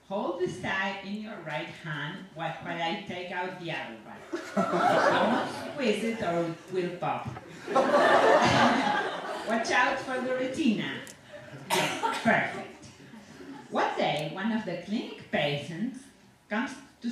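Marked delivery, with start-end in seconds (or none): 4.5-7.3 slow, louder, chuckling through the words; gleefully warning through a laugh. Acted. Dentist chuckles while warning his patient, laughing. 7.3-9.4 loud breathy cackle, pitched up; gleeful cackle. Acted. Dentist cackles at his own grim joke, loud laughter. 9.5-12.9 still laughing. Acted. Dentist gleefully warns blind patient.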